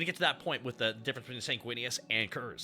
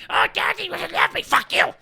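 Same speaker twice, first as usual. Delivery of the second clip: much higher, loud whiny jeer at Daddy; mocking childish whine; 9 dB louder than usual. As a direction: shriek it like a spoiled brat mocking his father. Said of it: "He's like".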